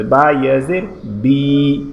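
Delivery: slow, with "বি" held long; drawn-out "বি". Shown as a demonstration. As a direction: lengthen the final "বি" to a steady ee. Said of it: "টেনে পড়তে হবে". ("বি" টেনে পড়া)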